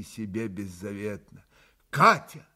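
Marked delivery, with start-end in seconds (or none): 1.9-2.5 sudden loud call of her name; exclaiming. A narrator's reading. Mitya suddenly calls out to Katya, loud exclamation. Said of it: "воскликнул вдруг Митя".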